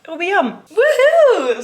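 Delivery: high, loud whoop of glee; gleeful woohoo; excited woohoo. Real, not acted. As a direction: cheer it out, thrilled. Excited cheer before cinema.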